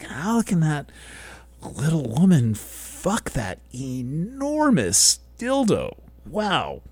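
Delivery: drawn-out mock astonishment, voice climbing high on the key words; mock amazement. Speaker voicing a viewer amazed at the athletes, in mock disbelief.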